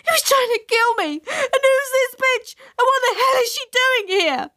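very high furious tirade; frantic, high-pitched accusation; hysterical yell. A narrator's reading. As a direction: shriek it out in outrage. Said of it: "yelled Jenny".

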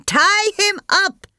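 high, slow shouted command; shouting. A narrator's reading. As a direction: order it high and hard. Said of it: "Sampath shouted to Sushila".

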